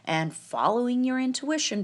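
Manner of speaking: a hint of exasperated onlooker; imagined scolding audience. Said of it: "I know you're all thinking".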